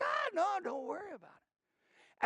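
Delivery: high-pitched airy reassurance; imitating carefree mother. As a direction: brush it off in a high voice.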